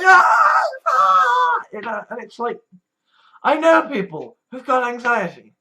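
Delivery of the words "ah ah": high, loud imitated screaming; mock pain screams. Pain screaming in her head, high mock shriek. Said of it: "It's just going".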